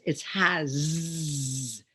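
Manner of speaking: HAS with a long held "zzz"; buzzing final Z. Shown as a demonstration. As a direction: stretch the end of "has" into a buzz. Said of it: "it's a Z".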